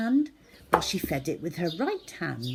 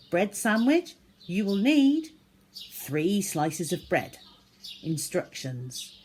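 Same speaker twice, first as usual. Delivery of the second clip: perky recipe-card voice, pitched up; recipe read-out; 2 semitones higher, 3 dB louder than usual. A narrator's reading. Zoe's recipe read out like a cookery show.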